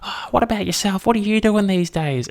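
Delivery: high, chirpy imitation of a lady on speakerphone; overheard phone caller. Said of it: "She's like".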